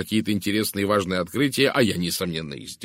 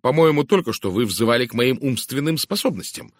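raised, louder, touchy retort; huffy; 4 semitones higher, 6 dB louder than usual. A narrator's reading. Narrator retorts to Poirot's slight, huffy. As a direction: answer back, stung. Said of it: "обидчиво подчеркнул я".

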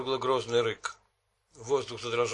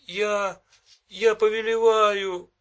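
desperate command; 9 semitones higher, 7 dB louder, slower than usual. A narrator's reading.

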